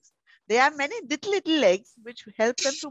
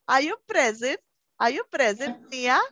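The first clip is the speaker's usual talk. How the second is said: usual speaking voice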